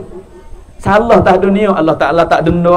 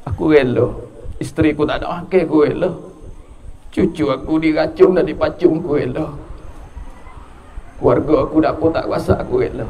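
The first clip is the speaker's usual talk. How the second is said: quiet resignation; 4 semitones lower, 5 dB quieter than usual. Retold or imitated. Prophet voiced accepting his family's suffering.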